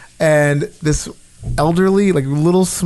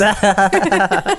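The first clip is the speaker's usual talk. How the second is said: amused laugh; 5 semitones higher than usual. Real, not acted. Laughing about farting story.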